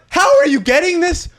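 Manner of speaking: high, incredulous whine; mock disbelief at the spam; exasperated quote. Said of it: "You're like".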